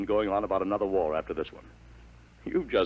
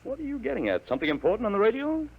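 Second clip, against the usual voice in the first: much higher and fast, pressing for an answer; baffled urgency; 14 semitones higher, faster than usual. Acted. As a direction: demand to know what's coming. Father baffled by son's prediction.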